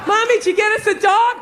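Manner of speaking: put-on a kid asking Mommy about the new dog; mock little kid shout; child voice. Voicing a child.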